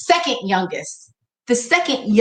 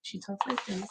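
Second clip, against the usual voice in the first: talking low; 4 semitones lower, 17 dB quieter than usual. Shown as a demonstration. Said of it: "all she had to do was lower her voice".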